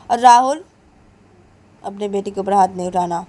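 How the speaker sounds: usual speaking voice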